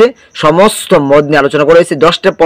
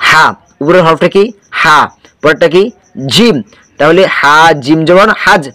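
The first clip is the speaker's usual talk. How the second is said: ordinary delivery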